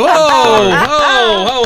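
loud, high stop-it whoa. Real, not acted. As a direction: call out to halt them. Host stopping the cursing.

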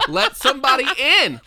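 laughing plea. Acted. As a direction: plead through laughter.

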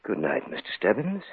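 soft, chilling goodbye; cold quiet farewell. Acted. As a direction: murmur it over the body. After the killing.

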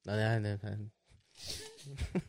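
low, soft mock refusal; understated, dropped-down retold reply; retold brush-off. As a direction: deliver the brush-off low and quiet. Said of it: "I'll be like".

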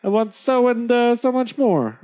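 high-pitched haggling trader; high, wheedling salesman to a chief; eager trader. Acted. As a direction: pitch it up like a trader making demands. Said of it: "The trader rubbing his hands says".

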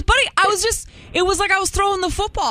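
high defensive dad voice. Retold or imitated. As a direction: play the clueless dad, fast. Dad's excuse voiced in story.

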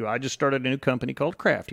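ordinary delivery

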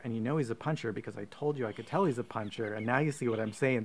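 normal talking voice

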